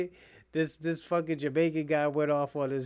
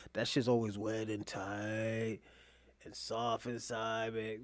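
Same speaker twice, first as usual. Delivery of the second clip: slow hushed voice; 5 semitones lower, 7 dB quieter, slower than usual. Acted. Slow lowered voice.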